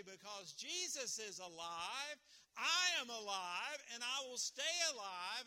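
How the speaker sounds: loud, slow, ringing declaration; triumphant proclamation. Acted. Preacher declaring it loudly to the congregation. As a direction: proclaim it like a victory. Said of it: "I can say".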